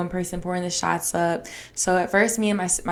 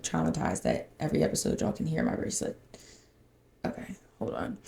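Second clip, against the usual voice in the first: breathy whisper, much softer; whispered aside; 9 dB quieter than usual. Real, not acted. To viewers while fixing noisy bracelets, whispering. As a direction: whisper it so the mic won't catch noise. Worried bracelet noise on mic.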